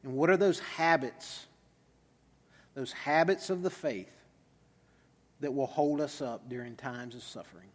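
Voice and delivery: soft, unhurried prayer; low, reverent praying voice. Real, not acted. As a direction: pray gently, trailing down to a quiet amen. Leads a closing prayer.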